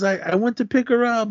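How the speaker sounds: high, imitated pleading; pleading explanation. Slapped boy explaining himself to Sonny, high mock plea.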